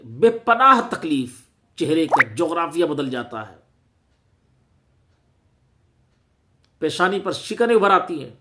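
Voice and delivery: loud, high, emphatic preaching; impassioned complaint. Real, not acted. Preacher complains that imams take offence at correction, impassioned and loud. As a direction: raise your voice in real indignation at them.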